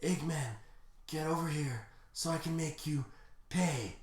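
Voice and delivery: soft, drained threat; weakened hero, quietly limping through the words; tired and weak. Acted. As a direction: threaten him with little strength left. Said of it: "very tired and weak".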